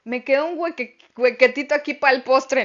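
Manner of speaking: jokey quote of a common saying; playful saying. Retold or imitated. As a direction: toss off the folk phrase with a grin. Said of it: "como a veces decimos".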